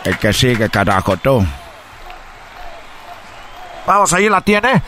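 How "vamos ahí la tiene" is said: high rapid play-by-play; breakneck goal commentary. Acted. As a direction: call it fast and high.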